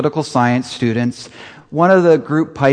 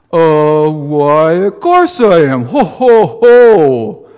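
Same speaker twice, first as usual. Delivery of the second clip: loud Brooklyn Santa with a ho ho ho; hammed-up Brooklyn mall Santa; Brooklyn accent; 3 semitones higher, 8 dB louder, slower than usual. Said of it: "a Santa with kind of a Brooklyn accent".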